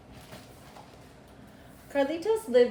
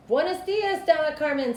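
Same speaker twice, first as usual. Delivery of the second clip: higher, louder friendly barber; cheerful barber greeting; 8 semitones higher, 6 dB louder than usual. Acted. Barber greeting Carmen at the shop door, bright and loud. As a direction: greet her warmly across the shop.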